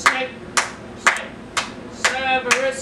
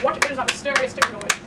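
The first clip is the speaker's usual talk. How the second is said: fast; much faster than usual. Shown as a demonstration. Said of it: "rushed retake".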